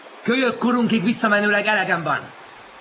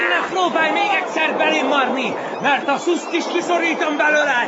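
high, strained warning; fighting threat; 11 semitones higher, 3 dB louder than usual. Acted. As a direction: threaten him mid-struggle, pitch up. Fighter threatens his rival.

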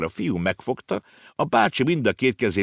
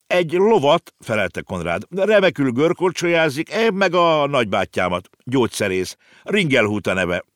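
put-on higher, louder eager boy; proud child's voice; 8 semitones higher, 4 dB louder than usual. A narrator's reading. Konrád introduces his horse and uncle: eager child's voice. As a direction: list them off proudly.

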